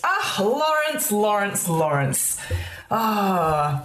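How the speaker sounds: a smug barman savouring the name; Mister Simmons. Acted. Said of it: "He goes".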